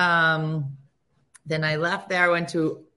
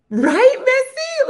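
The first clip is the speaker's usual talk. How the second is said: high, loud, drawn-out call; excited appeal; 8 dB louder, much slower than usual. Real, not acted. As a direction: beg her friend to agree. Calling to a friend for agreement.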